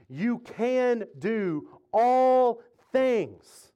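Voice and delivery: slow, punched word-by-word "all things"; emphatic declaration. Real, not acted. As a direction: stretch "all" long and land "things" hard. Preacher drives home the emphasized phrase.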